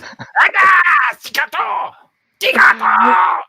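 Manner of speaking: high, shrieking demon voice; shrill, shouted creature cries in mock Abyssal. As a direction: screech it out in a fiendish tongue. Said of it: "Abyssal for".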